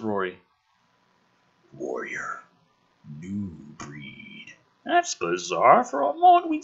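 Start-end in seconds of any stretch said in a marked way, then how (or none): 1.7-4.5 low, soft alien rasp, a touch lower; the dying creature speaking in a low, quiet voice; lightly voiced alien, low and fading. Dying predator answers via translator.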